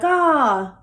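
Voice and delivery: loud, high pretend "ah" of tripping; mock falling cry. Girlfriend pretending to trip off the cliff with boyfriend, mock cry. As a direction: cry out as if accidentally slipping, hammed-up. Acting out accidental fall off cliff.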